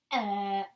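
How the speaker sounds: put-on open-mouth uh for the child; modeled uh sound. Shown as a demonstration. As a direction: show the sound to copy. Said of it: "in your mouth and go".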